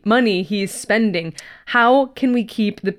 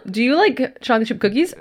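ordinary talk, nothing marked

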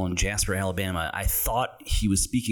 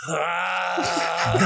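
exasperated yell; 10 semitones higher than usual. Shown as a demonstration. Movie watcher showing the noise he kept making, exasperated yell. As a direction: demonstrate your noise with a yell. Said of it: "the most common noise I was making".

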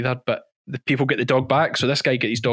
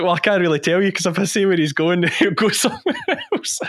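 giggling through the words, higher pitched; laughing; 7 semitones higher than usual. Real, not acted. On the phone to police, can't stop laughing. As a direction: laugh as you tell the cop you can't say. Retelling phone call while chasing.

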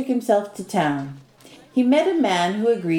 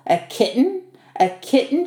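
crying out; 5 semitones higher than usual. A narrator's reading. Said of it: "she cried".

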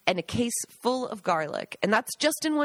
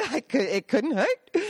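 laughing. Real, not acted. Repeats the quote laughing.

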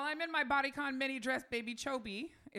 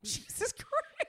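exasperated high groan; much slower than usual. Real, not acted. Exasperated exclamation.

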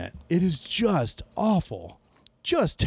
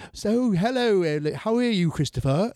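higher, mock-posh fake accent; mocking a bad accent; 4 semitones higher than usual. Mocking a friend's fake accent to his co-host, hammed-up. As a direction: parody a friend's awful put-on accent. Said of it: "Darian trying to do an accent".

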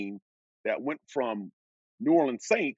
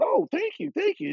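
much higher, gushing thank you; retold flustered thanks, pitched way up; overwhelmed thanks. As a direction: gush gratitude at the boss.

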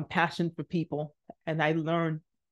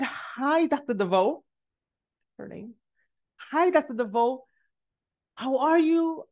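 higher-pitched polite hello; nervous greeting; 7 semitones higher than usual. Retold or imitated. Student greeting the professor she dreads, high and polite. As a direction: greet with a raised, over-polite voice.

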